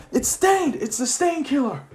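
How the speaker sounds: high, mock-panicked cry; acted alarm. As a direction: sound the alarm like startled police. Police panic at villain appearing.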